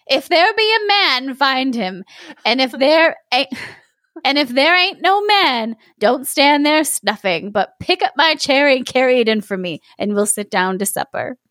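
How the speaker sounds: put-on high, sassy old woman; sassy grandmother. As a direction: scold him like a sharp old granny. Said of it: "said she".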